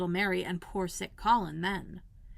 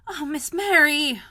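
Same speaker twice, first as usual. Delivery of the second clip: slow, higher and louder, near tears; a dismayed wail; half crying; 7 semitones higher, 8 dB louder, slower than usual. A narrator's reading. Martha dismayed at Mary, half crying. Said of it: "half crying".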